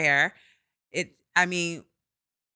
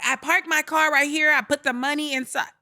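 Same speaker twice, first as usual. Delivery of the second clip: retold protest; 9 semitones higher than usual. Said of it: "And so I was like".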